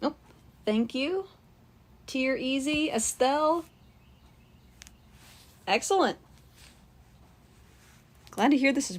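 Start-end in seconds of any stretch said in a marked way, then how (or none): none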